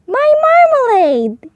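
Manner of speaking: high, loud, slow cry of relief; overjoyed to find the jar safe; thankful exclamation. A narrator's reading. Paddington overjoyed as his marmalade jar lands, high slow cry. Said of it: "he exclaimed thankfully".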